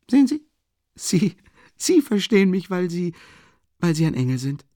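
high, loud, gushing rapture; a young man swept away with admiration; enraptured. A narrator's reading. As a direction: pour it out in delight. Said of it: "rief Rasumichin ganz verzückt aus".

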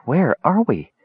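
high, bewildered question; dazed confusion. A narrator's reading. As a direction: ask as if just waking, lost. Dazed woman wakes from spell.

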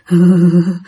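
low, shuddering moan; ghostly groan. Acted. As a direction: groan like a freezing spirit. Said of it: "bắt đầu nghe tiếng rên". (tiếng rên run rẩy)

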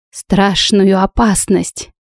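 usual speaking voice

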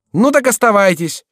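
high, loud call back; curt dismissal. A narrator's reading. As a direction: throw it back at a laggard. Chicot dismisses the reluctant rider.

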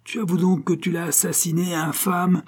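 high-pitched, outraged demand; furious. A narrator's reading. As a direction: press the accusation in outrage. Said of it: "ivre de fureur". (fureur indignée)

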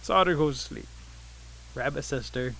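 ordinary delivery, nothing marked

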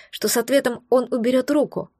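ordinary delivery, nothing marked

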